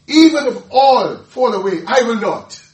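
loud, high, cocky vow with a laugh; boastful Peter. Acted. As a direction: puff up like a proud disciple swearing loyalty.

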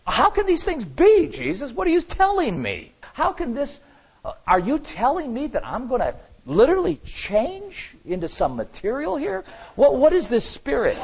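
high, stunned, disbelieving voice; incredulous disbelief. Retold or imitated. Preacher voicing Nicodemus questioning Jesus, high, stunned and incredulous. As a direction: pitch way up, as if he can't believe his ears. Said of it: "I wouldn't be a bit surprised if it was like".

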